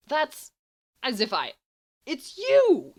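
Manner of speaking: very high, loud indignant squawk; splutter, then burst out at him; loud squawk of protest at a friend; sputtering outrage. Acted. Sputtering retort in a quarrel.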